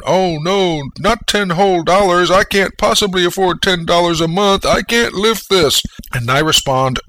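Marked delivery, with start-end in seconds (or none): 0.0-5.8 high, whiny mock complainer; hammed-up whiny moan, higher and louder. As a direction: whine it like a self-pitying coward, much higher. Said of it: "and you moan".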